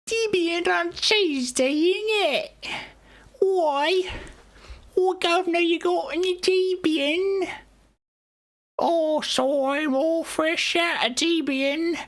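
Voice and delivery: high, slow, hammed-up British accent; silly British accent mocking English folk; cursed British accent. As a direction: overdo a cockney accent, way up high. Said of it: "increasingly cursed accents".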